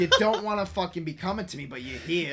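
loud, high hammed-up Azoff accent with laughs; Wolf of Wall Street voice. Coach on a call with a new client, loud hammed-up accent. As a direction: pump up the client like a pushy broker. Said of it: "You're fucking out of shape".

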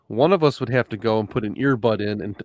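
ordinary delivery

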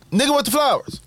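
high-pitched mock call; imitated call. Acted call from retold scene.

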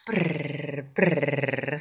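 soft rolled purr; cat purring. Retold or imitated. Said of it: "ruiditos de felicidad".

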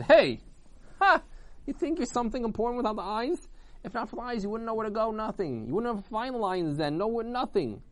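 high-pitched scoffing retort; acted indignation, pitched high; indignant arguing. A narrator's reading. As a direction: butt in and claim the credit. Eyes cut in on the quarrel.

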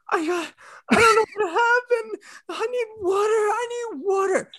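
very high tearful, breathless pleading; mock wail. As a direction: imitate a panicked partner crying for help. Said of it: "'Cause of your impression".

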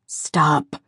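a hint of a steady boyfriend holding her; understated calm command; calm man. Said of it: "he says calmly".

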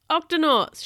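high, loud rallying call; big commanding holler; captain's shout. A narrator's reading. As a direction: shout to summon the whole crew. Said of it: "shouted the captain".

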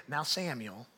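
soft, low Alabama drawl; mom's Alabama accent. Retold or imitated. Alabama mom addressing her son, soft Southern accent. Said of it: "this, this accent".

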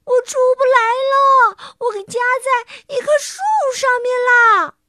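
high, loud wailing call; acted high-pitched plea; distressed shout. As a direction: call back as if stuck, long vowels. Stuck Wilbur shouts back from tree.

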